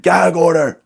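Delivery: loud, high mock scream of a dad; imitated scream. Dad screaming a lawyer term at his kids, mock scream. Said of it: "But he just screamed".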